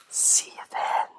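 soft, breathed-out whisper. Acted. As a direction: let it out softly with no voice. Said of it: "almost in a whisper".

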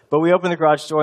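normal talking voice

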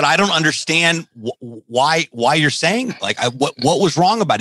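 high, baffled pleading; confused. Retold or imitated. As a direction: ask in stunned disbelief. Confused after harsh criticism.

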